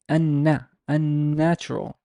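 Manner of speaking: "unna, unnatural" with the n held long; exaggerated double N. Shown as a demonstration. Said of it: "I'm emphasizing the, the stress here".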